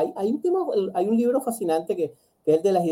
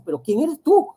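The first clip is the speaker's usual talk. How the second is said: a hint of a shocked stern father, pitched higher; 10 semitones higher, 4 dB louder than usual. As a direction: challenge her as a bewildered strict father. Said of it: "el padre que dice".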